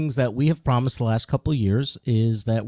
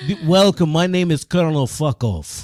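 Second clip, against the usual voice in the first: put-on Russian accent; 2 semitones higher, 4 dB louder than usual. Colonel introducing himself, Russian accent. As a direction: announce yourself in broad Russian. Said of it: "We could talk Russian".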